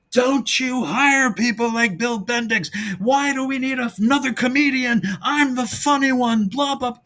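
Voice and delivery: very high, mock tantrum yell; Lou Costello screaming. Lou objecting to another comedian, in a high screaming tantrum. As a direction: holler it like an outraged comic star. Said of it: "Lou would scream".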